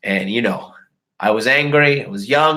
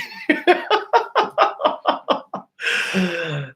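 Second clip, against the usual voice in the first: high laugh. Real, not acted. Laughing at own story.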